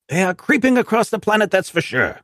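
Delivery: high, hearty officer voice; Brigadier voice. Acted. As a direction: greet the agent like an old commander.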